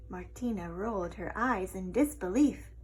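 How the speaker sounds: ordinary delivery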